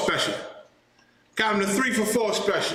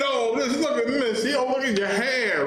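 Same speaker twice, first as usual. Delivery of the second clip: higher, lightly put-on doting voice; mock fawning; 5 semitones higher than usual. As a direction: coo over her hair in mockery. Mocking fawning over Missy.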